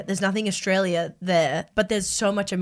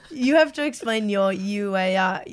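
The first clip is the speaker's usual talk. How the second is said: usual speaking voice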